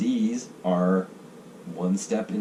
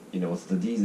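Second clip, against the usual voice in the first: ordinary talk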